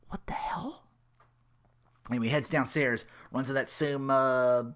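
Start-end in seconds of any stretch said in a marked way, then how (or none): 0.1-0.9 breathless whisper of disbelief; shocked whisper. Retold or imitated. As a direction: mutter it in stunned horror. Phil seeing the same day.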